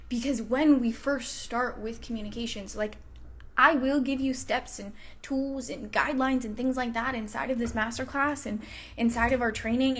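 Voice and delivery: usual speaking voice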